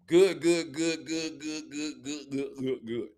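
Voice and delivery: good, good said quickly, fading away; mock skimming. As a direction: rattle off the praise, trailing off. Skimming Peyton's good traits.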